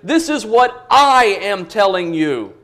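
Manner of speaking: "I" punched as the peak of the phrase; stress on I. Shown as a demonstration. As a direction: hit "I" hard to claim personal authority. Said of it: "really emphatic on the pronoun".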